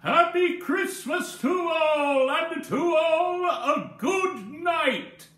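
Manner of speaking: high, slow, sung-out farewell; joyful exclaim. A narrator's reading. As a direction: ring it out like a merry goodbye. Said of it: "I heard him exclaim".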